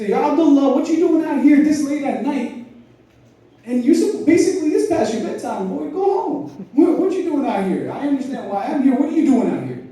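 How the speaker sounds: put-on high, pushed voice of a stern caliph questioning a boy; stern Umar. Umar catching a young man out at night, stern high questioning. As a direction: challenge him like a watchman catching a kid out late.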